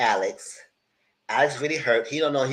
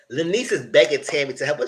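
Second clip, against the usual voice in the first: usual speaking voice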